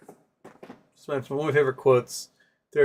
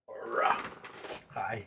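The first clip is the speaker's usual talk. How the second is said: usual speaking voice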